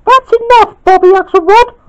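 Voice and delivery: loud, very high falsetto scolding; indignant falsetto character; cross Spinel. A narrator's reading. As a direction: scold in a piercing squeal. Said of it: "said Spinel crossly".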